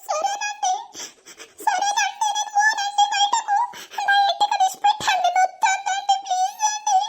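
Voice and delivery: very high, whimpering submission; tearful pleading. Acted. Wife giving in to violent husband, tearful pleading. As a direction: beg to be let go, crying in pain.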